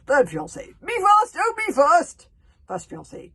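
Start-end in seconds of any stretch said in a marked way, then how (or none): 0.8-2.3 high, loud eager cry; eager shout. Acted. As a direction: clamour to be chosen. Fiancé begs to die first.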